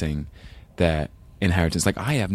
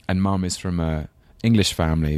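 usual speaking voice

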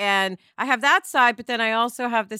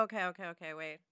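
much softer, hushed self-reassurance; calming herself; 5 semitones lower, 19 dB quieter, faster than usual. Retold or imitated. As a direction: talk yourself down gently, almost under your breath. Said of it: "And then I go".

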